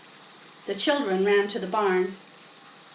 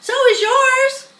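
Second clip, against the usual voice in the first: much higher, loud teasing cry; 14 semitones higher, 8 dB louder than usual. A narrator's reading. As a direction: shout it back mid-swing. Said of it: "cried Avery".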